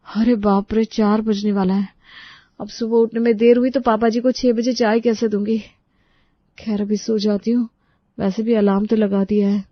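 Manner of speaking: worried bride thinking aloud; tired daughter-in-law. Acted. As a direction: bride alone late at night, worried then resigned. Preeti talks to herself.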